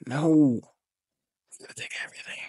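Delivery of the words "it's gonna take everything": a breathless whisper, almost no voice. Real, not acted. Telling viewers what success takes, in a whisper. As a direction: drop to a hushed whisper for the hard truth.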